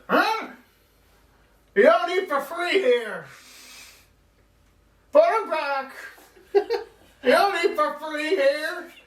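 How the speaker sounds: put-on high, gruff old shopkeeper; cranky old man telling them off. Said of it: "I like that old man voice".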